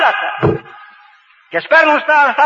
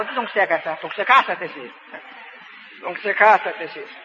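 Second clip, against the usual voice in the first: teasing aside; 6 semitones lower, 7 dB quieter than usual. Real, not acted.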